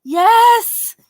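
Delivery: high, loud yes; emphatic yes. Retold or imitated. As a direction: slam the answer. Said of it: "he came here?".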